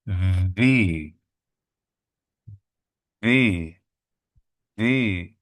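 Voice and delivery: "W" said as VAY, with a buzzed v; German W. Shown as a demonstration. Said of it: "The sound is created by".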